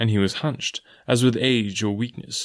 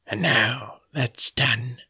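blind beggar; much slower than usual. A narrator's reading. Blind beggar finishing business, slow and cold. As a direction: draw it out coldly.

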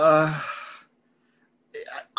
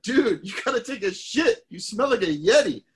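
raised dude voice; 10 semitones higher than usual. Retold or imitated. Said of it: "the other guy says back to him".

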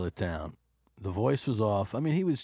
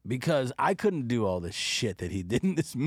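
normal talking voice

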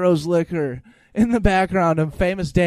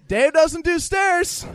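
much higher and louder, slow, stretched "doesnt"; incredulous repeat; 11 semitones higher, 5 dB louder, slower than usual. Real, not acted. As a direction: repeat it like you can't believe it. Repeats line in disbelief.

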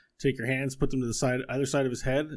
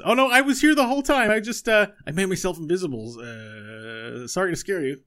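high, loud, eager little voice; cheerful squeaky apology; high eager inventor voice; Buttons apologizing; 7 semitones higher, 6 dB louder than usual. Acted. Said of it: "he says".

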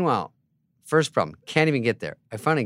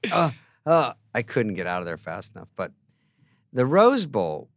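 normal talking voice

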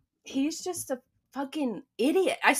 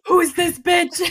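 mock shocked stranger; 7 semitones higher, 6 dB louder, slower than usual. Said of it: "I'm probably like".

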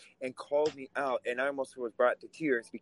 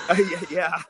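laughing; 4 semitones higher, 6 dB louder than usual. Real, not acted. Laughing at being called MAGA.

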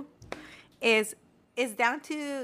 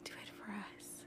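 whispering; 6 semitones higher, 18 dB quieter, slower than usual. Real, not acted. Pleading with Judy before her vote.

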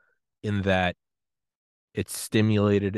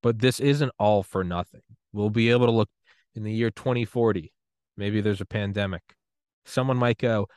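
normal talking voice

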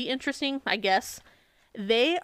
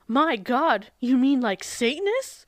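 imitated surprise; 3 semitones higher, 4 dB louder, slower than usual. Host quoting the author's exact response, mildly mocking surprise. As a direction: quote it with put-on wonder, pitch up on the last word. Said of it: "here's her exact response".

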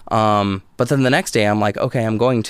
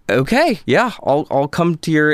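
flustered agreement; 6 semitones higher, 4 dB louder than usual. Retold or imitated. Agreeing after hearing mother died.

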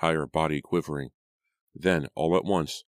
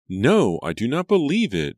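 loud, very high-pitched denial; disbelieving cry; 9 semitones higher, 8 dB louder than usual. A narrator's reading. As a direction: cry out, refusing to believe. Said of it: "Julia cried".

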